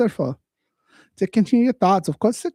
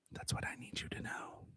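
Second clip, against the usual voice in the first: fully unvoiced whisper, close and intimate; ASMR whisper; 24 dB quieter than usual. Shown as a demonstration. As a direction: breathe the line like ASMR. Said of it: "A S M R".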